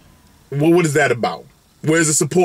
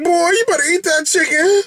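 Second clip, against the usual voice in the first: mom scolding; 11 semitones higher than usual. Retold or imitated. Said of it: "She's like".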